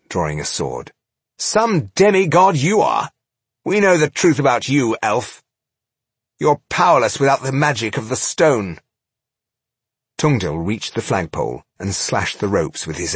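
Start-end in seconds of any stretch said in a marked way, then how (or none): 1.4-8.8 high, loud, mocking jeer; taunting actor. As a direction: crow over a fallen foe. Rodario taunts the Eoil.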